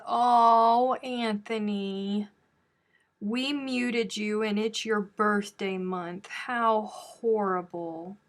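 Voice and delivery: mock sympathy, slow and drawn out, "Oh, Anthony" stretched; sarcastic. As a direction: fake pity for a muted viewer, dragged out slowly. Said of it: "Did you hear all that sarcasm?".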